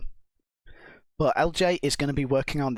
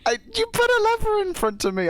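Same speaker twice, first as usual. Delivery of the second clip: high whiny protest; 3 dB louder than usual. Real, not acted. Defending herself after mistake.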